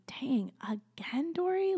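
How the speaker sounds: drawn-out, rising mock exasperation; exasperated self-talk. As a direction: imitate yourself fed up with yourself. Retells exasperation at crying again.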